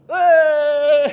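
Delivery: long, very loud high wail; mock baby wail. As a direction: bawl like a caught baby. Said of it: "he was crying and crying".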